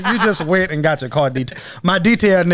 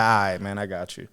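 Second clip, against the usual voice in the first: resigned; 9 semitones lower, 7 dB quieter than usual. Retold or imitated. Dad giving in to daughter.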